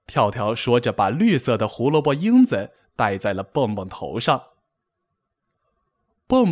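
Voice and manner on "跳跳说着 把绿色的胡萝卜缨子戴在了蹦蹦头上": plain reading voice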